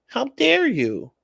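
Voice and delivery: high, slow mocking how dare you; mock outraged high voice. Mocking the offended partner in a slow squeaky voice. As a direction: squeak it out like a hammed-up offended diva.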